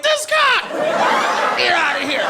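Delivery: high-pitched, loud squeal of false modesty; mock bashful exclamation. Comedian to flattering London girl, loud mock bashfulness. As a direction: shout it high, playing coy.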